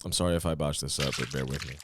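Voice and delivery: ordinary talk, nothing marked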